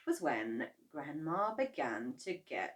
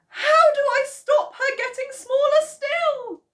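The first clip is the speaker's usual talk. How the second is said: very high, loud, desperate plea; desperate cry; 13 dB louder than usual. Acted. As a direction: beg in a high, panicked voice. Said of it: "she cried".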